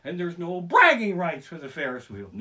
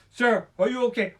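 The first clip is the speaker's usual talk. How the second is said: mock worried vendor; 6 semitones higher, 6 dB louder than usual. Snow cone vendor asks.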